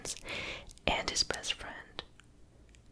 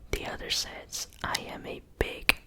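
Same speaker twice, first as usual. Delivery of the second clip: stress on its. Real, not acted. Whispers a joke aloud.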